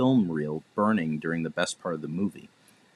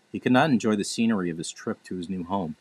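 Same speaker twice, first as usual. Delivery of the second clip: ordinary delivery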